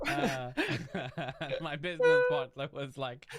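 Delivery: high, loud, laughing through the words; words broken by laughter; real laughing. Real, not acted. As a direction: struggle to talk while cracking up. Cracks up at siren imitation.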